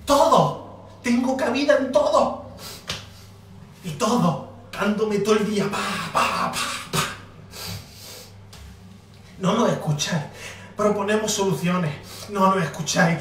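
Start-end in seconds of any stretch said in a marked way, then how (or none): 5.7-7.0 low thudding pa pa pa; imitated hits. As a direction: beat out each pa like a punch. Imitating blows all day. (golpes imitados, graves)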